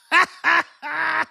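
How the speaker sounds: high, loud burst of laughter; embarrassed high laugh. Real, not acted. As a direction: cackle at his own blunder. Cracks up at himself.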